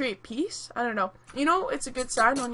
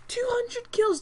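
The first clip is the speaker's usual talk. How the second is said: higher, flat statement of shock; incredulous exclaim; 10 semitones higher, slower than usual. Real, not acted. Player repeats the enemy's kill count in shocked disbelief. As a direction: state it like it's sinking in. Team lost, player stunned.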